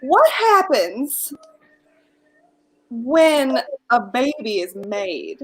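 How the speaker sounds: slow mock-innocent. Host asks naive question slowly.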